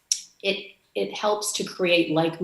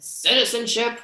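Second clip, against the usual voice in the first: a curt, mock gruff bark, pitched higher; retold bark; 7 semitones higher than usual. Grumpy customs officer barking at a traveller, curt mock bark. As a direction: snap the one word like a grumpy officer. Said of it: "he barked".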